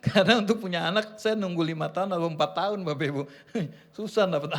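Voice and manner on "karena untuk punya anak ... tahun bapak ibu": normal talking voice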